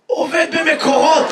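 slow, high, hoarse answer. Real, not acted. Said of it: "ממה אתה צרוד". (עונה בקול צרוד ומאומץ)

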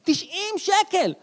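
high, slow, drawn-out shock at the price; outraged disbelief. Acted. Comic telling the crowd about formula, shocked outrage. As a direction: let the number hit like a punch, stretched.

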